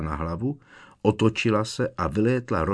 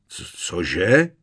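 stammered, louder disbelieving question; stunned; 5 dB louder than usual. A narrator's reading. Strýc Vernon hears the boy must stay, stunned. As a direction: stumble into an incredulous question. Uncle stunned by wife's decision.

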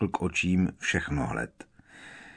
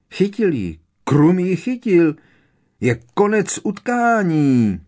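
higher, louder, rising joyful shout; hollering excitedly; 8 semitones higher, 5 dB louder than usual. A narrator's reading. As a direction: whoop the win to everyone. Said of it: "hulákal nadšeně Harry". (nadšené hulákání)

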